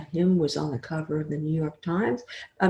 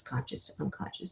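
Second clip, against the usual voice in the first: a trailing hush; whispered, fading; 5 semitones lower, 14 dB quieter than usual. Shown as a demonstration. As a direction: whisper it, almost gone. Drifting repetition into sleep.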